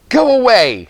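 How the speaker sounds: high, loud "go away"; sharp loud warning to the teasing boys; fierce shout. Acted. Red threatens the boys with shovel.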